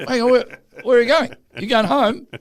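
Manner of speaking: high, puzzled imitation of the crew; mock confused crew. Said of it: "so he'd go".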